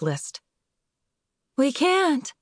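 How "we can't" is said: high, tearful wail. Acted. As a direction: cry it out, voice climbing high. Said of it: "Melanie wailed".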